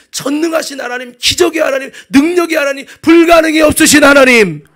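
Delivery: loud preaching building to a shout; full-force preaching. Real, not acted. Preacher extols the almighty God to congregation, full-force preaching. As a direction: build phrase by phrase to a climax.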